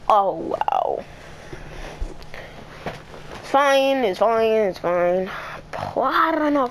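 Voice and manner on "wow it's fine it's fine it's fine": usual speaking voice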